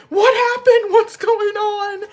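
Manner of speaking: alarmed husband. Retold or imitated. Husband rushing in after the explosion, high alarmed voice. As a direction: play the startled husband, high and urgent. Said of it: "My husband was like".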